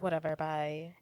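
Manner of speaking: soft, dismissive brush-off; cold shoulder. Retold or imitated. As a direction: imitate her brushing him off quietly. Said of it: "giving him the cold shoulder".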